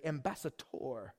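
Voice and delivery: slow ambassador, ending on a full, stressed "dor": ambassa-DOR; Terry's pronunciation. Retold or imitated. Preacher copying how Terry says ambassador, slow with stress on "dor". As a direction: draw out ambassador with the last syllable landing hard like "tor". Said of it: "or as Terry likes to say".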